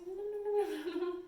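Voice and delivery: high, loud playful nibble nibble; nibbling giraffe. Shown as a demonstration. Teacher showing kids a giraffe nibbling leaves, high and playful. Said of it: "pretend to be a grateful giraffe".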